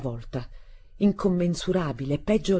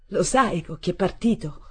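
plain reading voice

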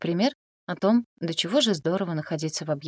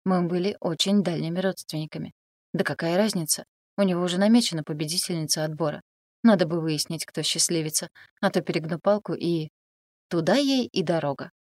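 ordinary delivery, nothing marked

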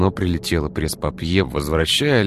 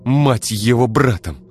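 hissing angrily; 7 semitones higher, 5 dB louder than usual. Acted. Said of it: "прошипела Ира".

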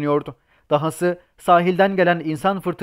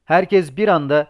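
usual speaking voice

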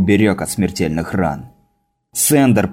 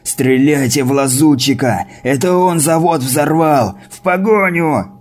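rising high urgent shouts; shouting; 5 semitones higher than usual. A narrator's reading. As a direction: yell orders after the car. Wounded man shouting orders.

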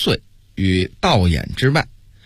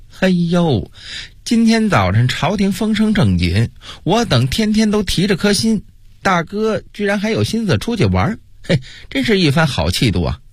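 high, sneering mock-sweet voice; snide sarcasm; 8 semitones higher than usual. A narrator's reading. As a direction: needle him with campy sarcasm. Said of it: "阴阳怪气儿地说". (阴阳怪气，尖声讥讽)

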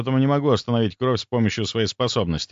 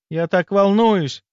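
higher, drawn-out excitement; взволнованно; 9 semitones higher, 4 dB louder, slower than usual. A narrator's reading. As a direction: confess your nerves, slowly and higher. Баронесса взволнованно говорит с дедом. (взволнованно, нараспев)

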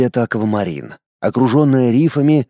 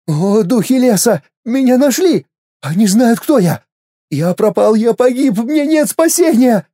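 panicked wailing; 9 semitones higher than usual. Acted. Inner voice panics.

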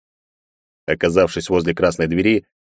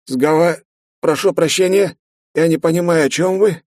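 puzzled rector; 10 semitones higher than usual. A narrator's reading. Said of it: "недоумённо начал ректор".

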